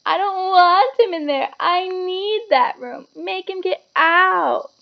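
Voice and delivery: high, slow wailing tantrum; a spoilt boy howling at his mother; Dudley bawling. A narrator's reading. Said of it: "Dudley bawling at his mother".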